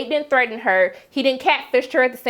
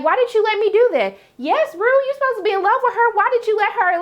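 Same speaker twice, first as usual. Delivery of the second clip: high-pitched, fussing telling-off; exasperated scolding; 9 semitones higher, faster than usual. Acted. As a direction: scold a friend in disbelief, voice raised in pitch. Acted scolding of Rue.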